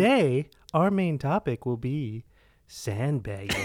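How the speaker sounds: slow processed robotic voice. Real, not acted. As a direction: announce it like a distorted robot. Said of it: "That sounds so bad".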